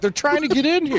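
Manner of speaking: high-pitched imitation of a panicking friend; hammed-up falsetto panic; mock freaking out. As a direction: squeal it like a camper under siege. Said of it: "He's, like, freaking out".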